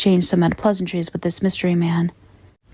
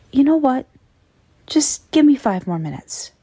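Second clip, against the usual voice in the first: pleading; 7 semitones higher than usual. A narrator's reading. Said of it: "sound like my son now".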